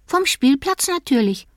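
put-on high, quick child's voice; disappointed little boy. A narrator's reading. Little boy telling parents the intruder is only Tommy, high child voice. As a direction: sound like a sleepy disappointed kid. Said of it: "sagte Nico".

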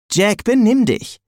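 scolding Alex. A narrator's reading. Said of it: "sagte Alex".

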